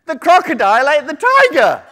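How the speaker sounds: high, loud triumphant squeak; muskrat voice. Acted. Muskrat ending the story for the tiger, high loud squeal. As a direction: build to a big loud reveal. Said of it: "said the muskrat".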